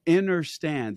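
"inner" in place of "under" in understand; understand said as "innerstand". Retold or imitated. Host quoting guest's striking word, understand pronounced "innerstand". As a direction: start understand with "inner", set apart. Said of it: "the fact that you said".

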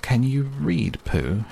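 a touch of nerves in Owl's question; a little anxious. Acted. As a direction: ask with a hint of worry. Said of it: "he asked, a little anxiously".

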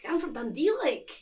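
lilting Dundee accent with rising place name; sing-songy Dundee accent. Shown as a demonstration. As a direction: sing it gently like a Dundonian. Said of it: "very sing-songy".

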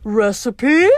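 drawn-out monster question; puzzled Cyclops. A narrator's reading. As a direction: ask it like a baffled brute. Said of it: "he asked Grover".

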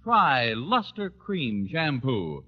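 usual speaking voice

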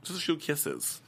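imitated soft, high-pitched protest; imitating repeated excuse. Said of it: "he keeps saying".

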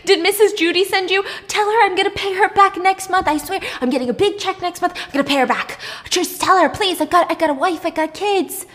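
high, hurried begging for mercy; panicked pleading, acted out. Tied-up man begging his captor not to kill him, mock panic.

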